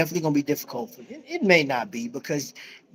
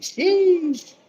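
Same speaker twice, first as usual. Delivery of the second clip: mock sheesh; 5 semitones higher, 3 dB louder than usual. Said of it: "they got me just like".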